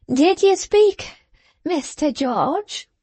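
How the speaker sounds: put-on high, slow, wheedling old man's question; old grandfather, inquiring. Old man inquiring of the smoking trooper, high and slow.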